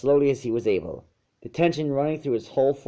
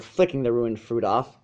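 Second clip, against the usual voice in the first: plain reading voice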